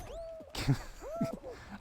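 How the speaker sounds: squealing laugh, very high; high-pitched giggle fit. Real, not acted. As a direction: let a high laugh escape. Reacting to awful game moment.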